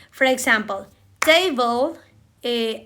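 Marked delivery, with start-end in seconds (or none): 1.2-2.0 "table" with a strong first syllable, TA-ble, rising at the end; stress on TA. Shown as a demonstration. Teacher demonstrating clapping on stressed syllables, stress on TA. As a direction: clap on TA in "table". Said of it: "say the stress syllable".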